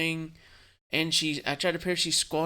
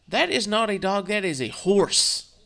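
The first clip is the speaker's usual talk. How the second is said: mother-in-law quip; 7 semitones higher, 6 dB louder than usual. Retold or imitated. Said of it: "She goes".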